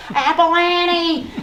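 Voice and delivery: long, high, loud holler, drawn out slow; high drawn-out farm call across the field; hollering for the cow. Retold or imitated. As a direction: call the cow from the back door, stretching her name. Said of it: "and holler".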